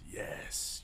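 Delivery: drawn-out voiceless whisper; menacing whisper. Acted. Dad answering the child's denial, menacing whisper. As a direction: whisper it as a looming threat.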